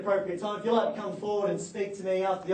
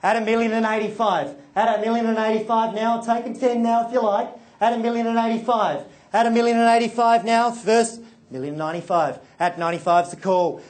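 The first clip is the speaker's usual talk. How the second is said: rhythmic auction bid calling; auctioneer call; 7 dB louder than usual. Real, not acted.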